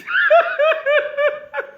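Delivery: high-pitched burst of laughter. Real, not acted. Vlogger taunting rival rapper, high burst of laughter. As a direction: cackle high after the taunt. Laughing off his own threat.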